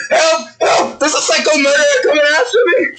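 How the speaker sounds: high, fast cry for help; hammed-up terrified guard fleeing; panicked yelling. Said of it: "He is yelling".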